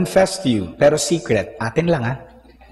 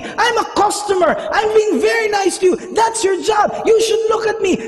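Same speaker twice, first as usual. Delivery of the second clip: very high-pitched, relentless angry tirade; infuriated rant. Retold or imitated. Customer telling off the guard who ignored him, infuriated. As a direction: re-enact scolding with outraged, rapid-fire complaints. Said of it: "I'm, I'm infuriated".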